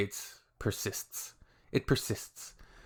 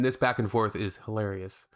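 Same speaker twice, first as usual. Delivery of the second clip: ordinary talk, nothing marked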